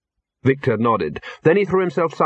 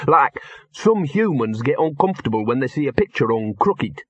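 earnest, slightly exasperated dog voice; talking dog explaining; 3 semitones higher than usual. Acted. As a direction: struggle to explain dog instincts to humans.

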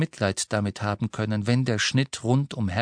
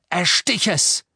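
higher, louder barked order; harsh urgent command; 8 semitones higher, 5 dB louder than usual. A narrator's reading. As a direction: force him to strike now. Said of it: "sagte Harry barsch". (Harry drängt Ron barsch zuzustechen)

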